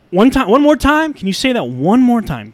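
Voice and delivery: high, loud, can you repeat that; incredulous. Real, not acted. Podcaster ranting about the news, high incredulous demand. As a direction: demand it again in disbelief.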